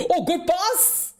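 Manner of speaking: high, stretched exclamation; excited reaction. Real, not acted. Commentator breaks off chat for a good pass, excited. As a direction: jump up in pitch on a live reaction.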